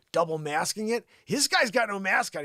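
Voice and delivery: usual speaking voice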